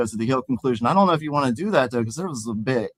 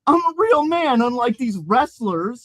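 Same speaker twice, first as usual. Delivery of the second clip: much higher, slow pouty whine; high crybaby voice; pouting whine; 9 semitones higher, slower than usual. Acted. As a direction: sulk it out like a big baby. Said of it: "continued to cry and pout".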